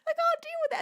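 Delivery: high tearful squeak; overwhelmed. Real, not acted. Streamer floored by ten thousand bits, overwhelmed. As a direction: squeeze it out through happy tears. Overwhelmed by huge donation, crying.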